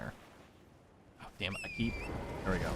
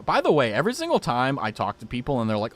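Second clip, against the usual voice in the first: normal talking voice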